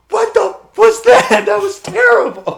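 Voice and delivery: high, loud, drawn-out outraged scream; mock screaming. Wrestlers react to a terrible joke backstage, mock screaming. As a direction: imitate a roomful screaming in disbelief. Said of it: "screaming, like".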